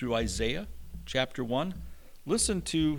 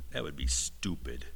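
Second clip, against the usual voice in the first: voicing Calvin; 10 semitones lower, 5 dB quieter than usual. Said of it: "Calvin would say probably".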